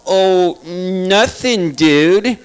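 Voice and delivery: drawn-out stoned nothing dude; laid-back guilty stoner; stoner driver. Retold or imitated. Stoned driver answering the cop, drawn-out nothing.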